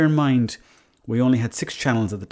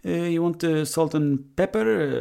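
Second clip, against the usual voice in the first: put-on high, slow Italian chip-shop accent; 7 semitones higher, slower than usual. Italian fella offering condiments at the chipper, Italian accent. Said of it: "So the Italian fella goes to me".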